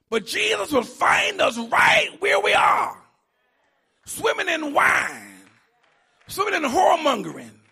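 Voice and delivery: loud, fast preacher's run; impassioned gospel preaching; full-force preaching. Acted. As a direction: drive it at the congregation.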